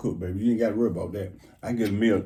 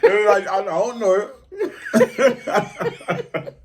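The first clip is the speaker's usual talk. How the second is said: laughing; 8 semitones higher, 5 dB louder, much slower than usual. Real, not acted. Laughing helplessly.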